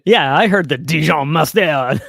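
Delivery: loud, hammed-up French accent. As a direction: join in with a big mock French accent. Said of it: "Where's the French accent".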